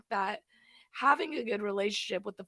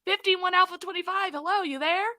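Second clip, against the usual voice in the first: higher, louder repeated radio call; urgent police radio; 9 semitones higher, 5 dB louder than usual. Retold or imitated. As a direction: call again, getting worried.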